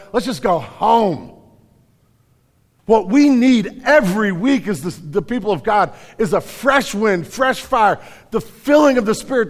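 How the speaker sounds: high, impassioned pulpit delivery; full-force preaching. Real, not acted. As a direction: preach it out with mounting intensity.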